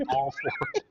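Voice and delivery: high, laughing "all four". Real, not acted. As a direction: laugh through it.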